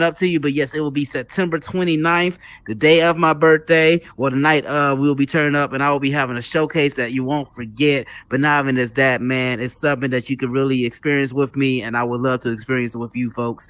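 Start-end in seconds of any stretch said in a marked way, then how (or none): none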